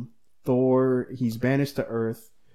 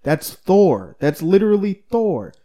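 emphatic retort; 7 semitones higher, 7 dB louder, slower than usual. Retold or imitated. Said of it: "someone was like".

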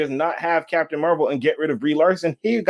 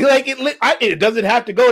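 shouting; 7 semitones higher, 3 dB louder than usual. Real, not acted. Shouted defensive insistence.

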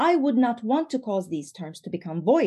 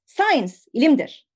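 higher, louder, eager exclamation; exclaiming; 4 semitones higher, 6 dB louder than usual. Retold or imitated. Boy finishing the famous saying for the baker, exclaiming. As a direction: rush to finish the saying, excited. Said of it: "İzzettin exclaims".

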